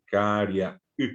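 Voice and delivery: Caria y slowly, with a break before the y; slow teacher's demo of Caria y; separated syllables. Shown as a demonstration. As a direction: split the voice before the final y. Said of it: "separo la voz allí".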